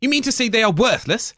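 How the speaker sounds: ordinary talk, nothing marked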